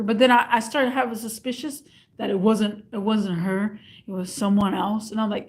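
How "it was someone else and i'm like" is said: usual speaking voice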